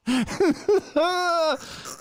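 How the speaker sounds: a sharp high cackle of laughter; high burst of laughter. Real, not acted. Cracking up at his own rant, a high laugh.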